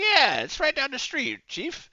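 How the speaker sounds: high, squeaky sarcastic informant voice; mock helpful informant. Player mocks an informant who just gives answers, high and squeaky. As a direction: play the snitch who is suddenly all too helpful.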